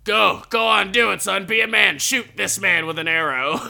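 high, loud egging-on dad; dad urges his boy to shoot the man; pushy dad. Retold or imitated. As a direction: goad the son on, loud and high. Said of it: "his dad just like".

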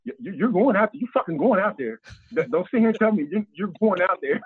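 high-pitched mock insistence; insistent retelling. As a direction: imitate a friend refusing to take no. Retold friend insisting he go out.

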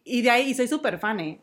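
normal talking voice

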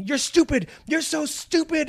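hammed-up yell of a mean mother; mother yelling stupid. Podcaster imitates the mother's insults, mock yelling. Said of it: "but the fight when they".

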